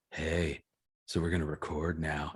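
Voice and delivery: low, soft mock-seductive male voice; man trying sexy. Host shows why a man's recording voice fails, trying sexy. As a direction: drop it deep and quiet, badly seductive. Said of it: "a man's voice trying to be sexy".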